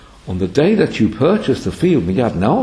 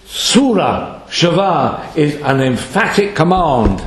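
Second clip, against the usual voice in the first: normal talking voice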